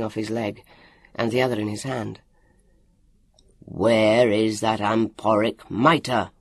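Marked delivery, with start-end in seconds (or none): 3.7-6.3 slow, drawn-out commanding roar; booming, drawn-out demand. A narrator's reading. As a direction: roar it out slowly, stretching each word. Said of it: "he roared".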